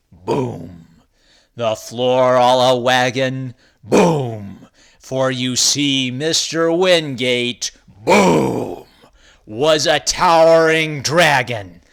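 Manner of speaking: loud, big storytelling; dramatic booming narration. A narrator's reading. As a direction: boom it out for the dragon's entrance. Dramatic dragon arrival narration.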